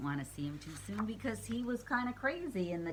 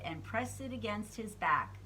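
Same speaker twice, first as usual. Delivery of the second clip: usual speaking voice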